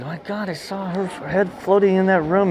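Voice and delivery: telling the guide about the ghost, high and urgent; excited retelling. Retold or imitated. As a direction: blurt it out, still shaken by the ghost. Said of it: "I said".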